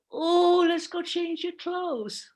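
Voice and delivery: put-on high, drawn-out baby-talk lilt; sing-song high caregiver voice. Shown as a demonstration. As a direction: coo it slowly, far above your normal voice. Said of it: "go into a higher pitch".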